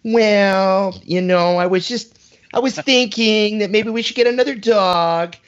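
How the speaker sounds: exaggerated high-pitched wife impression; Bill Burr woman voice. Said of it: "what Bill Burr does, where he's like".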